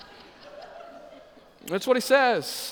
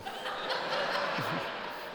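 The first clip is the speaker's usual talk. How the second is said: soft breathy laugh; 5 semitones lower, 11 dB quieter than usual. Real, not acted. Preacher laughs at his own joke.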